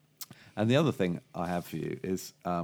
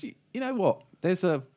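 higher, eager barman; a higher, chatty landlord voice; 9 semitones higher than usual. Acted. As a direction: voice the barman with a tip to share. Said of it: "the barman goes".

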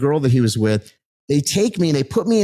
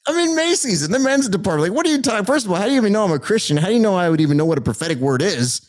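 much higher retold disbelief, quick at the end; incredulous; 7 semitones higher, faster than usual. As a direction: replay your own baffled reaction, pitched way up. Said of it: "And I'm just like".

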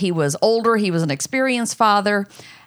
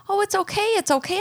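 imitating a soothing nurse; 13 semitones higher, faster than usual. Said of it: "And she's like".

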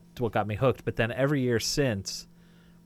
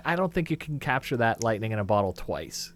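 usual speaking voice

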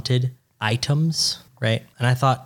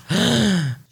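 mock gasp; 7 semitones higher than usual. Mock amazement.

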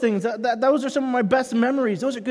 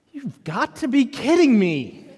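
slow, drawn-out disbelief in a put-on voice; mock exasperation; 3 semitones higher, slower than usual. Dad's silent reaction to daughter, mock exasperated disbelief. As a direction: stretch it out, comically incredulous.

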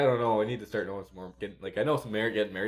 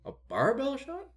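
baffled bartender; 8 semitones higher than usual. Retold or imitated.

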